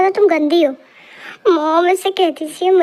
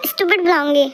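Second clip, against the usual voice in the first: normal talking voice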